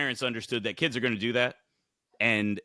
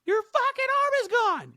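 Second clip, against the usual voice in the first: put-on much higher child voice blurting it out; shrill kid blurt. Kid yelling, voiced in story.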